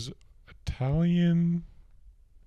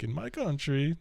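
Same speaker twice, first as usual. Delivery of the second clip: put-on high Borat accent; Borat impression; 7 semitones higher, 6 dB quieter than usual. Woman quoting Borat to a counselor in his accent. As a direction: imitate Borat's foreign lilt. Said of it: "and he's like".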